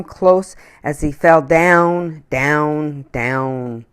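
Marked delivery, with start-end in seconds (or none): none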